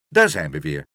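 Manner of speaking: big, low-pitched arrival call; hearty doctor. A narrator's reading. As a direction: boom out a greeting as the doctor. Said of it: "riep de dokter".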